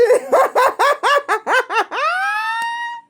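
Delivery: long cackle rising to a squeal; real, very high laughing fit; helpless cackle. Real, not acted. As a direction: cackle until the breath runs out. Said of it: "laughing in tears".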